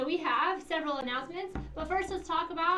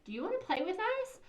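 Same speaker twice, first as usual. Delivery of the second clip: put-on bright, much higher kid voice; friendly invite; 9 semitones higher than usual. Principal models a kind playground invitation, high and bright. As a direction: play the welcoming classmate.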